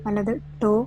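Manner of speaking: slow yoga nidra voice, drawn-out words and long pauses; guided relaxation. Real, not acted. Yoga teacher talking listeners through relaxing each body part, guided meditation. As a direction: lead the body to let go, unhurried, phrase by phrase.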